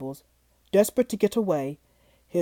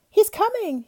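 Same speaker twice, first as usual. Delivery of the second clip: high, excited call; 12 semitones higher, 3 dB louder than usual. Acted. Beauty spotting her father coming home, high excited call. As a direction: call out to the house. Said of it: "she called".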